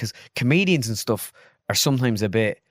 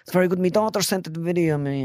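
higher-pitched imitation of a delighted Irish comedian; Tommy Tiernan; 7 semitones higher than usual. Comedian praising the man who impersonates him, high-pitched impression. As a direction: lift the voice, mock-impressed. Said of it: "he turns around and he's like".

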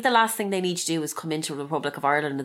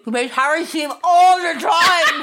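louder, slow protest through laughter; laughing protest; 10 semitones higher, 5 dB louder, much slower than usual. Real, not acted. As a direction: half-shout it while cracking up.

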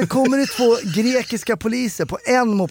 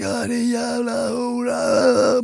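high, drawn-out imitated scream; mock a distant yell, stretching every word; retold scream; 4 semitones higher, much slower than usual. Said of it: "någon som skriker". (återgivet utdraget skrik)

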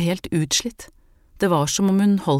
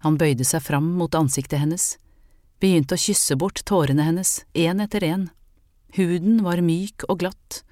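ordinary delivery

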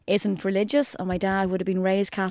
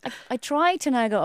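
normal talking voice

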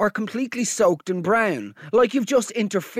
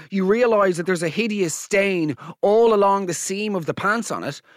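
ordinary delivery

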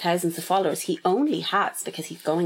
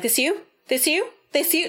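mocking callout; 8 semitones higher than usual. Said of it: "they were like".

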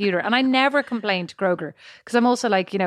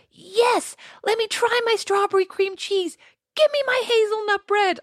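much higher, eager put-on voice; imitating Bo; 13 semitones higher, slower than usual. Wife voicing Bo wanting treats, eager squeaky imitation. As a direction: squeak it out like a greedy little one. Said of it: "And Bo's like".

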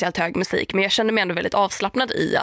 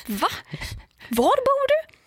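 much higher incredulous question; mock astonishment, voice jumping high; baffled; slower than usual. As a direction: ask it in pure disbelief. Own astonished reply.

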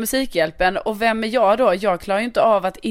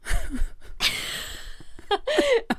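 high breathless laugh; 5 dB quieter than usual. Real, not acted. Flustered laugh over the word.

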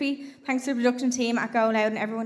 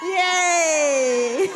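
cheer; 2 semitones higher, 5 dB louder than usual. Real, not acted. Cheering winner to crowd.

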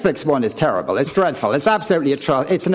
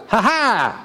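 mock stage-Irish exclamation, pitched up; put-on Irish cry, higher than usual; stage Irish accent; 5 semitones higher than usual. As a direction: ham up a Hollywood Irish "ha-ha". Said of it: "Hollywood wants even Irish actors to do a".

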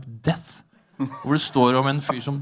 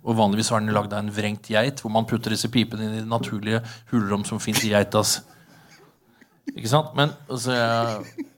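ordinary delivery

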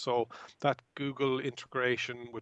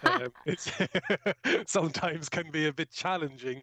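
laughing; 4 semitones higher than usual. Real, not acted. Joking about his Mayo accent.

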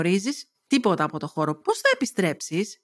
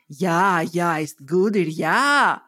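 low, slow, proud German guest; a deep-set proud tourist praising his dish; proud German diner; 4 semitones lower, much slower than usual. Acted. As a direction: drawl it out with swollen pride. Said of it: "λέει όλο περηφάνεια".

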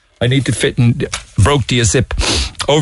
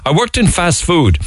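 normal talking voice

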